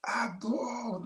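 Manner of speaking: imitated yelling at a friend. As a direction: call his name up high like a telling-off. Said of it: "sometimes I'm screaming at him".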